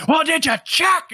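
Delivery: high, loud, slow mock shriek; imitated sudden scream. Detective to unhelpful nurse, sudden imitated scream. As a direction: burst into a drawn-out mock scream out of nowhere. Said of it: "screams at the top of his lungs".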